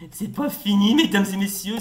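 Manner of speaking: loud, booming proclamation to a crowd; mock showman announcement. Player tells viewers it's not over, mock showman.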